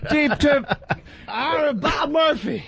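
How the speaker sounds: high, shouted radio call; mock announcer. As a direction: yell it like a ballpark announcer. Mocking the cut-off call.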